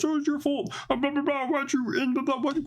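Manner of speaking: high-pitched mocking commenter voice; dismissive, high mimicry of blaming comments. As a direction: imitate nagging critics, pitched far up. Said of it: "wanna say in the comments".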